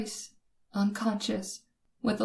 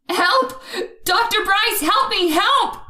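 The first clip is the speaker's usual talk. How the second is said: high, loud, terrified screams for help; screaming for help; 12 semitones higher, 9 dB louder than usual. Acted. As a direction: scream for the doctor with everything you have. Cornered by knife attacker, calling.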